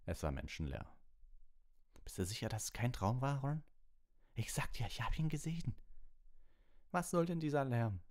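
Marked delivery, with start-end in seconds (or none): 2.0-5.7 tartışan çocuk. A narrator's reading. 6.9-8.0 indignant complaint. A narrator's reading. Complaint about the noise.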